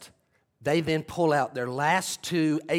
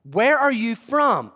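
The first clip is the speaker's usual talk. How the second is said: pressing Pilate; 7 semitones higher, 7 dB louder, slower than usual. Retold or imitated. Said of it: "he would have asked this multiple".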